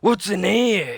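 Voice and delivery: high, loud gruff question; captain grabbing the boy's backpack; pirate demand. A narrator's reading. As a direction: demand to know. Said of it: "he said".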